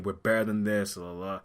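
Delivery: usual speaking voice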